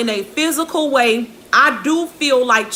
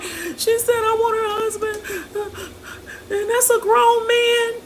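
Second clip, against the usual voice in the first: crying indignation; 12 semitones higher than usual. Retold or imitated. Upset kid protests to her mother, high crying whine. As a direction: whine it like a hurt kid near tears. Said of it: "And I'm like".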